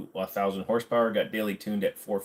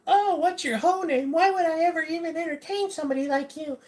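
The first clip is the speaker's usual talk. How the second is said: mocking high prissy voice. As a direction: play a snooty offended girl, way up high. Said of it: "when you're like".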